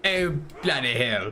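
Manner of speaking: slow put-on London accent. Shown as a demonstration. Streamer trying accents for chat, mock London accent. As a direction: try out a London accent, drawn out. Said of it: "Like a London accent?".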